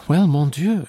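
animated cry of protest; exclamation. A narrator's reading. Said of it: "she exclaimed".

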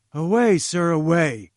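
loud, angry dismissal; loud furious order to leave; angry cry. A narrator's reading. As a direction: drive him out of the room with an angry cry. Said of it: "he cried angrily".

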